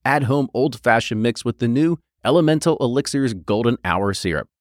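upbeat sponsor read. Acted. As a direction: read it like a commercial spot.

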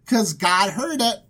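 ordinary talk

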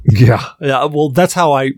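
ordinary delivery

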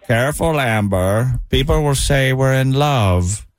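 slow, creepy villain voice; lightly voiced cannibal courting his prey; measured, unhurried menace; Hannibal Lecter. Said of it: "hear it one more time".

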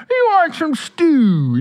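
put-on high, slow Cajun accent; drawn-out high Cajun accent. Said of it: "Cajun, he's like this".

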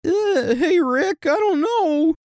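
very high, nervous Morty stammer; squeaky anxious teen voice. Retold or imitated. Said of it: "in a Morty voice from Rick and Morty".

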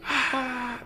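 high yelping scream; imitated scream. Man faking possession screams high. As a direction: let out a sudden high cry. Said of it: "Pero así fuertísimo".

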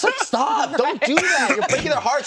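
high, loud mock protest; pleading imitation. Partner defending the dogs' feelings, high mock pleading. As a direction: plead in exaggerated falsetto.

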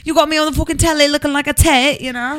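put-on jokey Essex accent answering the driver; mock Essex accent. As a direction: give it a cheeky Essex telling-off. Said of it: "I was like".

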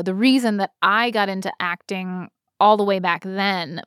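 ordinary delivery

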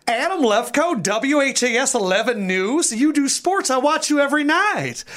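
high, excited recognition; imitating a suddenly starstruck mother. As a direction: switch from grief to fan. Grieving mother recognizes him.

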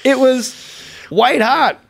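amused friend raving about a sketch; laughing. Real, not acted. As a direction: laugh through the praise.

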